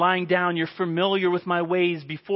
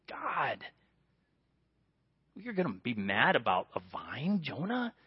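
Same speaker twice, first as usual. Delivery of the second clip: God's gentle voice; 8 semitones lower, 9 dB quieter than usual. Retold or imitated. God's voice questioning Jonah.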